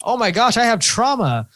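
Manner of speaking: high, bright-eyed mock discovery, pitched well up; mock realization. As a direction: act out suddenly realizing it about yourself. Listing mock self-discoveries.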